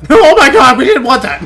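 high, loud hammed-up alarm; mock panic. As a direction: yell it like a comically horrified victim. Voices victims of the grenade.

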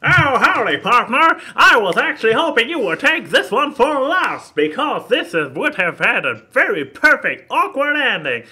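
hammed-up American southern accent; old prospector. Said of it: "like an old prospector".

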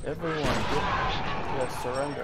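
loud barked orders; shouting commands. Real, not acted. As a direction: yell at the soldiers to surrender. Yelling commands at enemies.

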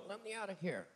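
thrown voice, as if trapped in the suitcase; muffled voice from the luggage. Retold or imitated. Ventriloquist throwing a muffled cry from the suitcase. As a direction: call out faintly, as if shut inside the bag. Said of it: "I'll go".

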